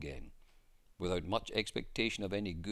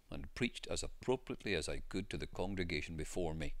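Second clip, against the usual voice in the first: ordinary delivery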